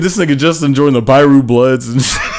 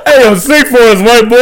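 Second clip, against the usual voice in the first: laughing; 11 semitones higher, 4 dB louder than usual. Real, not acted. Laughing demand.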